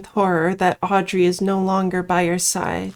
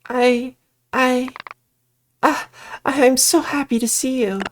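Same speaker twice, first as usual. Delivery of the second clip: higher relieved voice, overplayed; fake Audrey; 4 semitones higher than usual. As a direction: pretend to be the lost friend. Witch posing as Audrey.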